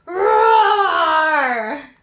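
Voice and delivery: huge loud playful roar; monster roar. Shown as a demonstration. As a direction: roar along with the kids. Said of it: "Can you roar too?".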